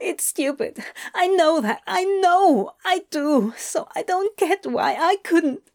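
high, strained, halting upset; choked. Acted. Adam blaming himself to Crowley, choked up. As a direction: squeeze it out, close to tears. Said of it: "Adam choked hoarsely".

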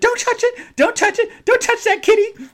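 very high, loud falsetto squeal; squealed warning. Retold or imitated. Mock-warning the friend off the kitty, squealed falsetto. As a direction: shriek it like a scandalized lady.

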